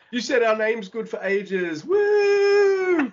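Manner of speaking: high, drawn-out mock cheer ending in a soaring woo; cheering crowd. Wrestlers tossing a departing colleague in the air, high gleeful cheer. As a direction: whoop it up like a celebrating crowd. Acting out cheering fans hoisting him.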